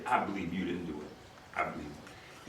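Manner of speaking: softly voiced officer talking a prisoner down; calming officer. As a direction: settle the scared kid down gently. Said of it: "He calmed me down".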